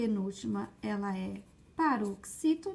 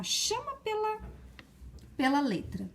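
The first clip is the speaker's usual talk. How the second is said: high-pitched, sustained call; calling demonstration; 10 semitones higher than usual. Shown as a demonstration. Teacher showing pupils how to find stress, calling out. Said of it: "Eu costumo dizer assim".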